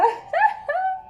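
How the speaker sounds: high delighted laugh; gleeful high-pitched laugh; triumphant laugh. Acted. Laughs after husband curses her.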